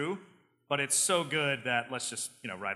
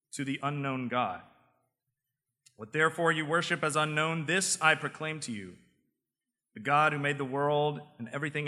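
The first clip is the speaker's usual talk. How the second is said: raised, preaching Paul addressing Athens; 3 semitones higher than usual. Acted. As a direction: proclaim it to the crowd like Paul. Paul's speech read as preaching.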